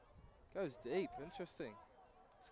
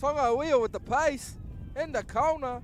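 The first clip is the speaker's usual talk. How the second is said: loud, higher rising call; excited play-by-play; 7 semitones higher, 8 dB louder than usual. Real, not acted. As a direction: build the call as he breaks. Break toward the try line.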